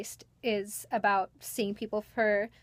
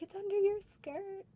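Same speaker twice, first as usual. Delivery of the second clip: high-pitched mock child voice; imitating amused kids; 10 semitones higher, 3 dB quieter than usual. Kids point out the ball to Belle, imitated in a high voice. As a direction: pipe it up like a tickled kid. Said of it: "they thought that was hilarious".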